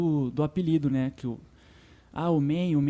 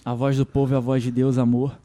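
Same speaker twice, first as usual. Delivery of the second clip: a hint of the singer teasing him; 4 semitones lower, 8 dB louder than usual. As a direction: lower and louder, teasing. [name]'s reply quoted.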